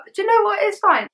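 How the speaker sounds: high brushed-off. Real, not acted. Brushing off getting hurt.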